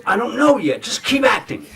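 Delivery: high, loud exasperated outburst; imitated director's yell. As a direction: yell back at an impatient actor, hammed-up. Acted director snapping back at actor.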